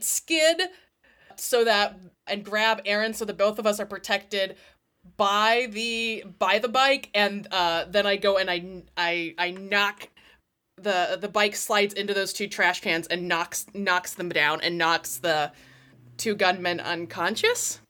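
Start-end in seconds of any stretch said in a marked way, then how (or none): none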